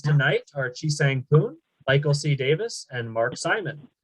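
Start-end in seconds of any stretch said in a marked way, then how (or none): none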